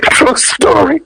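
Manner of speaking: high-pitched, squeezed out through laughter; real laughing. Real, not acted. Storyteller cracks up after his own punchline, laughing. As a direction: say it while cracking up.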